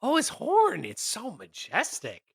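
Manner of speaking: high gasp falling to a hushed marvel; awestruck gushing. Acted. Tiefling explaining herself over his horn, awestruck gushing.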